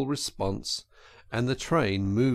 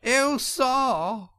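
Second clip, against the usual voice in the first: high-pitched wail in a put-on woman's voice; crying Toad as washerwoman; 13 semitones higher than usual. A narrator's reading. Toad appeals to the engine driver, wailing high. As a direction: cry out piteously, pitched way up. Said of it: "cried the Toad, crying afresh".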